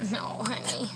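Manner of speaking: soft whisper to the dog; quiet whispered scolding of a pet. Real, not acted. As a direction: hush a gentle correction. Gently stopping dog.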